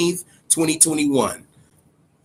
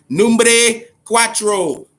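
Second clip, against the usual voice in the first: slow, higher, louder drawn-out announcer call on "Numbre cuatro"; hyped announcer; 7 semitones higher, 5 dB louder, much slower than usual. Real, not acted. Hyping the new UFC game to viewers, drawn-out announcer call.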